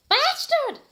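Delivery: hammed-up shriek, very high and loud; angry shout. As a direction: spit it out in mock fury. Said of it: "I shouted angrily".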